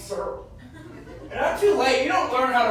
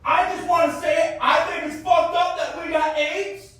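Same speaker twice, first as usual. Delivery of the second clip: loud, higher rant to strangers; yelling at the train; 6 semitones higher, 4 dB louder than usual. Retold or imitated. Man ranting to passengers on a train, loud yell. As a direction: yell it to the whole car, earnest and raised. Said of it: "yelled out on a full train".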